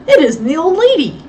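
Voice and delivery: startled realization; surprised. A narrator's reading. Miss Tyson spotting the wrong captive, surprised and loud.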